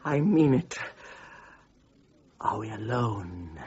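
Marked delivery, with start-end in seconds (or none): 2.4-3.6 soft, slow conspiratorial ask; hushed question. Acted. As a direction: lower the voice, checking. Sly low question.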